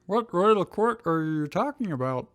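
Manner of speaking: high, lightly puzzled Drew. Acted. Drew asking Ash about the royal court, puzzled. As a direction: sound baffled by the term. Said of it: "looking puzzled".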